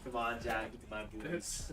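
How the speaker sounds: a deep action-hero impression with a laugh breaking in; Arnold AI voice. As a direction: growl it out like a muscle-bound action star. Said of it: "Like the Arnold A I".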